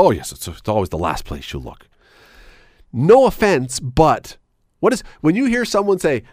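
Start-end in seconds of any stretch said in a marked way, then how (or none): 0.0-1.9 mocking cliché voice. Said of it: "But we say it all the time".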